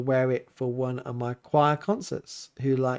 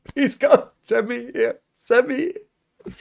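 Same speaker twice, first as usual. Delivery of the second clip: high, pleading mock prayer, slowing at the end; 10 semitones higher, 3 dB louder, slower than usual. Joking about wanting the parish job, mock pleading prayer. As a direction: beg God in a hammed-up plea. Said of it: "It's like".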